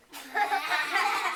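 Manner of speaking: high, loud villain laugh; loud gleeful cackle. Acted. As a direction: cackle with glee. Dingo's laugh.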